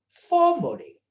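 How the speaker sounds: formally punched high and loud, falling at the end; stress on formally. Shown as a demonstration. Lecturer to class, stressing formally with a high, loud punch. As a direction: hit the word formally hard. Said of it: "I emphasize the word formally".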